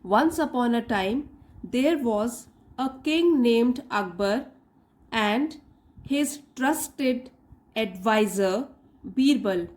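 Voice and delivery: very slow reading, phrase by phrase with pauses; careful model reading. Shown as a demonstration. Teacher reads story for learners minding punctuation, slow careful reading. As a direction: read slowly, stopping at each break for learners to follow. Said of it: "punctuation को भी ध्यान में रखेंगे".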